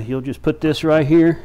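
usual speaking voice

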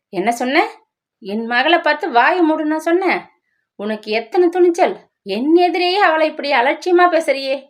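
a mother-in-law flaring up in defense of her daughter; furious. Acted.

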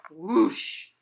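loud rushing whoosh; wind whoosh. Acted. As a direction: blow it like the wind. Wind sound in story.

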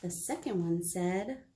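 plain reading voice

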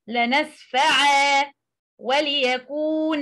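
لنسفع and وليكون ended on a long aa in place of the tanween; stopping with substitute alif. Shown as a demonstration. Teacher demonstrating the stop on both verbs, final vowel lengthened. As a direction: hold a two-count alif at the stop of لنسفع. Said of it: "alif is substituted for the tanween when stopping".

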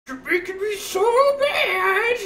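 high, slow mock whimper; whiny monkey voice. Streamer voicing the angry monkey for chat, high and whiny. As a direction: voice the sulking monkey, near tears. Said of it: "he's like".

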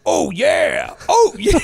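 booming high mascot shout "Oh, yeah"; Kool-Aid Man shout. Retold or imitated. Host imitating the mascot crashing through a wall, booming shout. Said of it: "the Kool-Aid guy's going".

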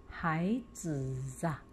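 孩子啊 read slowly with 啊 sounding as 扎; drawn-out model reading of 孩子啊; 孩子啊 read aloud. Shown as a demonstration. Teacher models 孩子啊 for pupils to repeat, slow and soft. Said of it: "请同学们来 跟我一起读".

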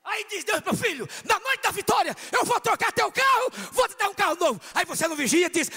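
loud, high, full-force preaching; fiery preaching. Acted. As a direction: preach it to the whole hall.

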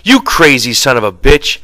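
exasperated near-yell; near shout. A narrator's reading. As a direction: let the anger rise almost to shouting. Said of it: "His voice rose nearly to a shout".

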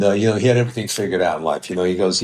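normal talking voice

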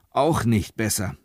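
ordinary delivery, nothing marked

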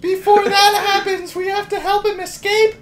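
put-on sing-song little girl diary voice. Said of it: "Read it in a little girl voice".

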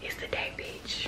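slow, hushed, gleeful whisper; a slow excited whisper. Real, not acted. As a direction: whisper it like a secret thrill. Whispered hype for tomorrow.

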